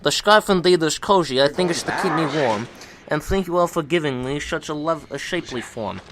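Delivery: low, loud snowman voice. Acted. Reads Bob the snowman's poem.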